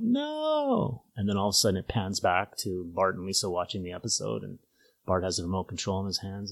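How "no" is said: high-pitched retold cry of refusal; Lisa's mock scream. Cartoon girl rejecting a suitor on live television, mock scream. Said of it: "she screams out".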